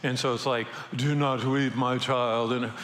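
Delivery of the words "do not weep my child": majestic Jesus. Retold or imitated. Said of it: "it feels majestic 'cause it's Jesus".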